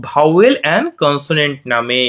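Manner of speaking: teacher introducing English terms slowly. Shown as a demonstration. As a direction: spell out vowel and consonant at a crawl for children. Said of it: "আমরা ইংলিশে শিখছি".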